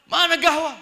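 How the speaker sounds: loud, high mock-angry demand; angry husband. Husband about to rage over his coffee, mock angry shout.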